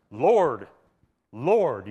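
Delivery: preacher voicing the double Lord; emphatic double Lord. Shown as a demonstration. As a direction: proclaim Lord, Lord with certainty. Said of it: "the double emphatic is used".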